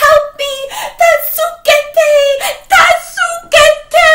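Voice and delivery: high, loud, fast terrified screams; shrieking old man crying help; screaming for help. Acted. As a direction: scream for your life. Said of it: "he called out".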